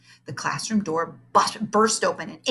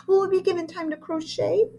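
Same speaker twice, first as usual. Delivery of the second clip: put-on child voice; 11 semitones higher than usual. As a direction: ask hopefully like a kid. Hopeful child asks.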